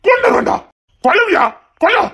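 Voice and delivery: high, strained furious yelling. Acted. Rival daring him to kill, furious high yell. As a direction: scream the dare back in his face. Heated mutual death threats.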